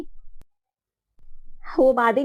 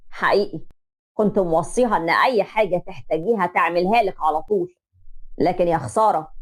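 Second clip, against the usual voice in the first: lower, reproachful father's voice; disappointed father; 7 semitones lower than usual. Acted. Father blaming the groom's mother, low and reproachful. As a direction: let him down gently but firmly.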